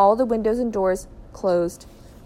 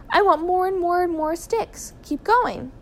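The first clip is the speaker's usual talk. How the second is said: urging; 10 semitones higher than usual. A narrator's reading. Father urging family to fetch sticks.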